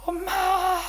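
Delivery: high tearful cry of mom; crying call. Retold or imitated. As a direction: cry out to mother on the phone. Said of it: "전화를 해서 막 우는 거예요".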